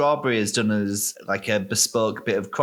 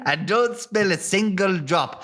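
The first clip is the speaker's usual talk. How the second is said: higher-pitched rustic farmer; Farmer Buggerton; 6 semitones higher than usual. Acted. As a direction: warn them like a vaguely Irish farmer. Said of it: "why is he Irish?".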